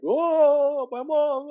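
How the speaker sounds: high, loud, drawn-out mock wail; hammed-up movie-reunion sobbing cry. Son parodying a Hallmark movie reunion, mock wailing. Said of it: "and cry and all that stuff".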